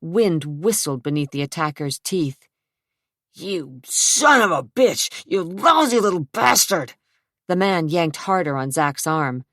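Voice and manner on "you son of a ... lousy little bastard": angry thug cursing. Acted. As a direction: spit the insults out in pain. Thug kicked in shin.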